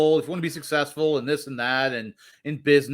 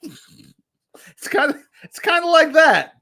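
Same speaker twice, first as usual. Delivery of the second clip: laughing; 12 semitones higher, 6 dB louder, slower than usual. Real, not acted. Laughing at own fart joke.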